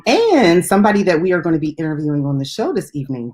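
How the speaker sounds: ordinary talk, nothing marked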